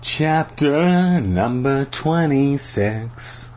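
slow, stylised chapter heading; chapter announcement. A narrator's reading. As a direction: announce it with drawn-out words.

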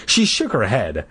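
usual speaking voice

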